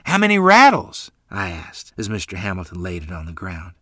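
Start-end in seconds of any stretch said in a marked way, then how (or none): 0.1-1.1 excited. A narrator's reading. Boy asks eagerly.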